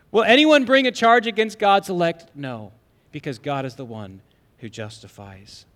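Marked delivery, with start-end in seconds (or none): 0.1-2.3 loud, high, driving sermon voice; full-force preaching. Acted. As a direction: preach it out with fire.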